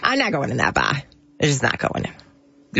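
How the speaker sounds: a stubborn Boston local; Boston accent. Acted. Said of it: "This is your character".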